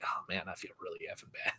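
hushed, guilty whisper. Retold or imitated. Friend recalling how bad he felt about calling off, guilty whisper. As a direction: whisper it like a confession. Retelling guilty inner thought.

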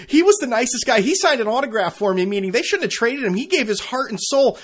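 loud, high-pitched protesting fan voice; mock indignant fan. Podcaster mocking fans upset over Beckham's trade, loud high indignation. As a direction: imitate an outraged defender pleading the player's case.

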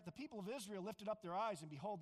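usual speaking voice

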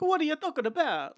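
high mock Italian-American mobster accent; imitating a movie mobster's Italian accent.

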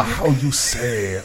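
slow mock French accent. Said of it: "Can you say that in a French accent".

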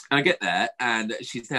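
usual speaking voice

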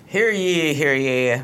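booming proclamation, hear ye; town crier. Pretend. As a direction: call it out like a herald. Opening a mock royal announcement.

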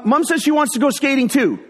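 high, called-out message; son yelling up. Retold or imitated. Son passing on mom's request, calling out. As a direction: relay it up the stairs, mock yelling. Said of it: "he yells up".

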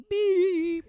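high, loud held beep tone; one sustained high-pitched beep; imitated censor beep. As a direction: mimic a swear-word bleep. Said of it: "it would all be like".

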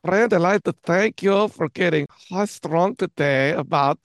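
comically terrible mock-German accent; bad German accent. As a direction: sign off in a hammed-up German accent. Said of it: "Worst German accent ever".

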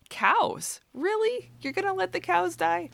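laughing incredulity about the cows; incredulous. Real, not acted. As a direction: ask it in high, amused disbelief. Incredulous at God's mercy for cows.

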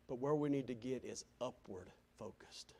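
hushed, whispered point. Real, not acted. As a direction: drop to a whisper to land it. Whispered climax.

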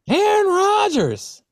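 drawn-out, high announcer tease; milk the name like a newscaster; mock news anchor. Host teasing the next news item, mock anchor.

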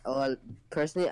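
ordinary talk, nothing marked

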